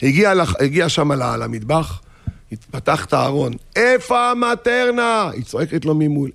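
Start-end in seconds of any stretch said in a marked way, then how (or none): 3.8-5.3 high, drawn-out holler; calling out. Acted. Husband searching the kitchen, hollering to his wife. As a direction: call across the house, stretching the words. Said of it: "עכשיו צעקה שתיים".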